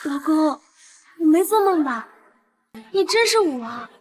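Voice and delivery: very high-pitched wonder; amazed wife. Acted. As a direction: marvel at him in happy disbelief. Wife amazed by husband's identity.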